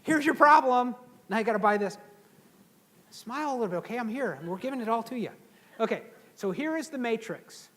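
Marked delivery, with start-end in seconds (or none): 0.0-2.0 much higher and louder hard sell; pushy salesman. Retold or imitated. Mocking seminar hucksters who upsell, loud and high. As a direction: ham up a slick salesman pitching. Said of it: "Don't you hate guys that do that".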